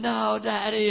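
high-pitched mock wail of a kid refusing; drawn-out high pleading cry, played for laughs; child's panicked protest. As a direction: imitate a flailing, protesting little boy. Flailing child refusing the slide.

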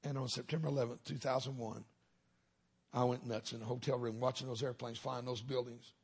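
ordinary delivery

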